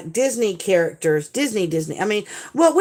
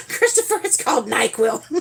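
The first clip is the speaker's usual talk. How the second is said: words squeezed out through laughter; giggling at her own naughty joke; laughing; 11 semitones higher than usual. Real, not acted. Mom joking to husband about NyQuil, laughing through it.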